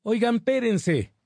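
high calling out; near shout. A narrator's reading. Yanira calling to friends through the crowd, a high near-shout. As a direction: call them to wait up. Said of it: "casi gritó Yanira".

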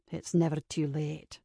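soft, low man's voice; low male reply. A narrator's reading. Kincaid contradicts Ava at the wedding, low and soft. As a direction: answer low and quiet, as a man.

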